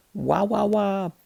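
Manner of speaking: high, thrilled wow-wow guitar sounds; gleeful high-pitched guitar imitation; excited wow. A narrator's reading. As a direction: burst out in delight at the new sound. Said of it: "Les said".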